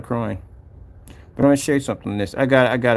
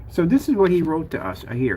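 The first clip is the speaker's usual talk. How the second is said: ordinary talk, nothing marked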